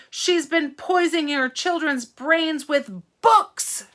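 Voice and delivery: slow loud accusation; declared. Acted. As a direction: whip up the crowd. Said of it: "Trout declared".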